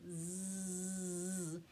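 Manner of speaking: a long buzzing zzz demonstrated; bee buzz. Shown as a demonstration. Teacher showing children the Z sound, buzzing. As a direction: hum the z like a bee. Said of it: "When I make the Z sound".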